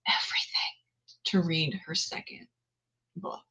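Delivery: breathy whispered repeat of "everything". Real, not acted. Booktuber echoes her promise, whispering "everything". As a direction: whisper "everything" to yourself like a secret.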